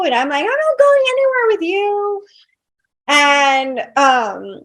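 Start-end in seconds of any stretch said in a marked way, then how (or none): 0.4-2.3 high, indignant refusal; defiant refusal. Retold or imitated. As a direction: fire it back at him, much higher and indignant. Refusing husband in quarrel.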